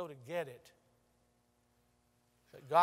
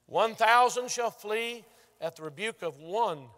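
scripture proclaimed; 3 semitones higher, 8 dB louder than usual. Real, not acted. Quoting scripture with force.

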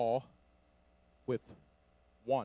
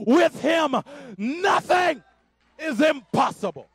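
"nothing" lifted high and shouted, then a pause; stress on nothing; 14 semitones higher, 14 dB louder, slower than usual. Acted. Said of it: "because God said".

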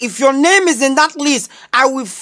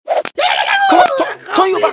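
high, strained yelling order; furious teacher yelling; teacher shouting. Acted. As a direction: bark the order at the pupils.

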